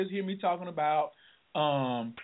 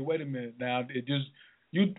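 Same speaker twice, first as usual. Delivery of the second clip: normal talking voice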